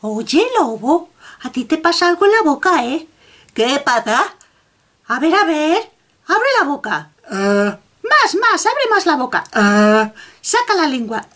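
a hint of a fussy little rabbit checking a mouth.

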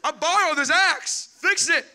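high, loud panicked whine; freaking out. Pretend. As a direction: freak out over the lost axe. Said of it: "and I'd be like".

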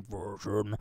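monster voice. Retold or imitated. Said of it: "horrible monster that follows you around going".

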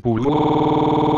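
stretched high warped voice; slowed pitch-shifted curse. Acted.

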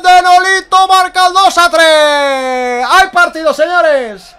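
stretched loud goal call; spanish goal commentary. Real, not acted. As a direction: belt it out, slow and drawn. Goal called.

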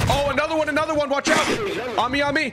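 high, urgent warning; urgent callout. Real, not acted. Gamer warning his teammate about another enemy, urgent and high. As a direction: alert the teammate fast.

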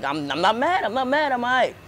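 insisting you're not mad, voice pushed up; sulky kid swearing he's fine; defensive denial. Retold or imitated. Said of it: "I'm like".

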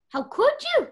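high, loud cry of betrayal; betrayed outrage. Acted. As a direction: hurl the accusation up high. Feels betrayed by Mary.

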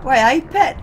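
a ridiculously awful try at Geordie; Geordie accent. Retold or imitated. Streamer imitating a Geordie while chatting to friends, loud mock Geordie. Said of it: "attempt at a Geordie accent".

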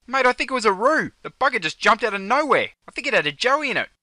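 high, loud excited report; startled and excited. Acted. Passenger telling the driver about the roo that jumped out, excited. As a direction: blurt it out, worked up.

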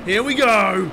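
loud, pumped race-start shout; excited. Real, not acted. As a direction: fire up the launch like a fan. Race start, launching off the line.

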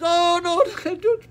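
put-on high, loud wailing child begging; child crying. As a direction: cry out like a child about to be spanked. Child begging not to be spanked.

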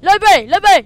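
high, loud repeated demand; insistent shouting. Real, not acted. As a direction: yell it to get your way. Insisting on the library.

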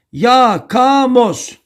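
Yakamoz stretched out vowel by vowel; teacher drilling Yakamoz in long, slow syllables; syllable-by-syllable mnemonic. Shown as a demonstration. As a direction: draw out each syllable of Yakamoz slowly. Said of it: "A, A, O kuralı".

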